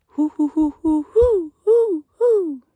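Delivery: high falsetto hooting in a steady rhythm; owl call. Shown as a demonstration. Camper imitates the owl he heard, high hoots. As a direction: hoot high like an owl in the night. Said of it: "I don't have the best owl call".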